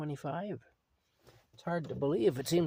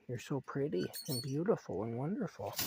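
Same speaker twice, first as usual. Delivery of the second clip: normal talking voice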